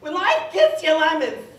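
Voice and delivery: crazy-grandma screech, climbing very high; hammed-up batty old grandma; imitated grandma. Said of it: "she's always like".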